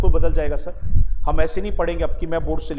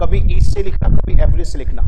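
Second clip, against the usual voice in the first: loud dictation; 10 dB louder than usual. Shown as a demonstration. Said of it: "अब मैं जो जो word बोलना चाह रहा हूँ वो लिखो".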